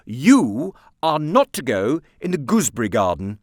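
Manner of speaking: loud stern command; stern aunt. A narrator's reading. Aunt forbidding Nicholas, stern and loud. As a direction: lay down the rule.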